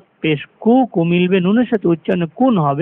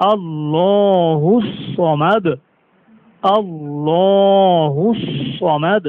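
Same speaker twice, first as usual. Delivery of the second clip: slow, drawn-out chanted recitation; slow tajweed recitation of আল্লাহু সমাদ; Quran recitation; much slower than usual. Shown as a demonstration. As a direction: recite it slowly with full tajweed. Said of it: "আমরা পড়বো সমাদ".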